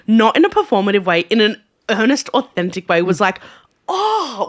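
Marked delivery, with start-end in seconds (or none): 3.9-4.5 high, blown-away "oh"; overawed gasp. Retold or imitated. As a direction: react as if knocked back by the singing. Reaction of being pushed back.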